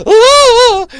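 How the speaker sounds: high scream like a little bitch; shriek. Retold or imitated. Spike remembering everything, shrieking high. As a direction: shriek in high-pitched terror. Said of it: "He's like".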